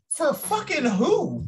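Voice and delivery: high, slow outraged disbelief; incredulous. Real, not acted. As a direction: drag it out in disbelief. Incredulous retort on witch hunts.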